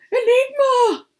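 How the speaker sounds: high, loud joyful call of a name; joyful cry. Acted. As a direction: grandpa crying out in delight at his lost rabbit. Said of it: "Grandpa cried with joy".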